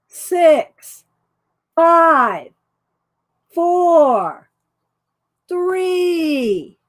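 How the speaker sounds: slow, drawn-out countdown. Real, not acted. Host counting down for viewers to enter numbers. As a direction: build suspense with each number.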